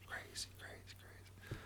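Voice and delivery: faint unvoiced whisper in the head; haunted whispered loop; echoing whisper. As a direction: breathe it like an inner echo. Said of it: "it would echo in my head".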